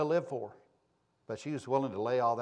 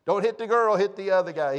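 high, raised mock-urgent voice; comic re-enacted warning; urgent shouted instructions; 5 semitones higher, 7 dB louder than usual. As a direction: plead loudly with arriving officers.

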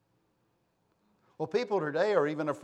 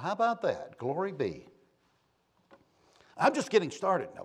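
ordinary talk

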